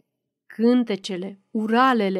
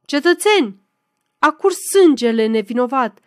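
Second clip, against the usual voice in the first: loud, raised orator's address; ringing voice; 2 semitones higher, 6 dB louder than usual. A narrator's reading. Candidate to the crowd after a wounding, ringing oratory. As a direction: declaim it to a public square. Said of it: "cu glas vibrator".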